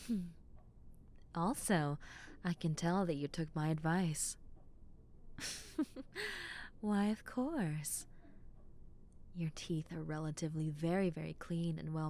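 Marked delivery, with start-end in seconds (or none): 5.2-6.8 soft amused giggle; a pleased little giggle; high giggle. Acted. Playful giggle.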